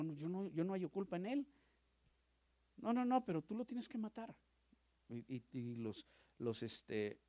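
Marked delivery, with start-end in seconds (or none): 0.0-4.4 higher, raised acted back-and-forth; lightly voiced dispute; acted exchange. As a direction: play Pilate against the crowd. Voicing Pilate and the crowd.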